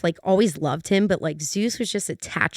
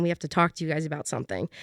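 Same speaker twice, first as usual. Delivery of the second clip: quieter, fast, grave boss announcement; serious boss voice; 5 semitones lower, 8 dB quieter, much faster than usual. Retold or imitated. Boss sitting the staff down for serious news, quiet and fast. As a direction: deliver bad news hushed and quick.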